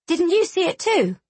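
put-on high, eager child voice; a small girl, proud and hopeful; little girl voice. A narrator's reading. Young Riel asks Tal.

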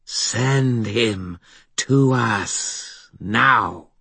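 slow, high, inhuman demand; eerie voice. A narrator's reading. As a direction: drag out each word like a ghost. The voice from the stone.

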